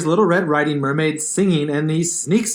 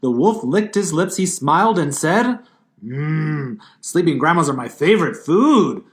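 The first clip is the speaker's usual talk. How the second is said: ordinary talk, nothing marked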